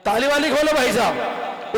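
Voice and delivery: rallying call. Acted. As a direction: call out for applause. Rallying audience for applause.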